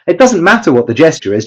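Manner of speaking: ordinary talk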